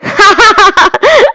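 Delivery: high burst of friends' laughter; loud laugh. A narrator's reading. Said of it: "वो जोर से हंसे". (दोस्तों की ज़ोरदार हंसी)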